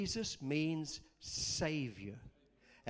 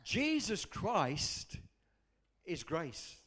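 ordinary talk, nothing marked

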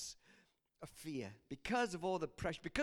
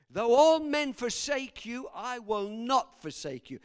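slow, loud, ringing pledge; emphatic vow; 5 semitones higher, 11 dB louder than usual. Acted. Peter swears loyalty to Jesus at supper, emphatic vow. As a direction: swear it loudly, weighing each word.

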